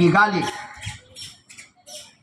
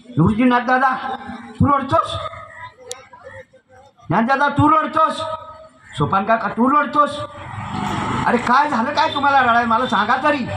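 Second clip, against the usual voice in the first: high-pitched, insistent questioning of elder brothers; acted child's anxious questioning, high; pleading little sister; 7 semitones higher than usual. Little Mukta questions her crying brothers.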